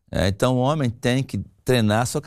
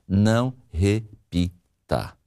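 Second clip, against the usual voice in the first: very slow, syllable by syllable; emphatic, drawn-out; 4 semitones lower, much slower than usual. Real, not acted. Coach instructs women not to repeat themselves, slowly spelled out. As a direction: space out every syllable for emphasis.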